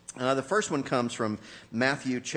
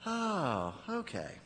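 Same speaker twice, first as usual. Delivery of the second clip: softer, deflated little reply; sheepish oh okay; 2 semitones higher, 9 dB quieter, much slower than usual. Retold or imitated. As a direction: shrink back with a meek, quiet okay. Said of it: "And you go".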